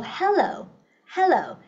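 "hello" slow, ending on a clear O; O sound in hello. Shown as a demonstration. Teacher modelling the letter O sound, slow and clear. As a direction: stretch out the O in "hello". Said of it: "mình đọc là O".

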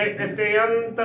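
ਇਤਯੰਤ said i-te-ant; "iteant" for ਇਤਯੰਤ; demonstrated pronunciation. Shown as a demonstration. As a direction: open the y of ਇਤਯੰਤ into e-a. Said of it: "ਉਚਾਰਨ ਕਰਨਾ ਹੈ".

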